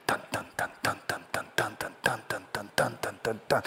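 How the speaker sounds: soft, rhythmic drum-like "dun dun dun"; low-level steady percussive beat; driving music imitation. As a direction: pulse it quietly like a soundtrack. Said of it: "like that driving kind of music".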